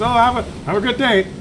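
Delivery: a lightly voiced ranger letting it slide. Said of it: "He goes".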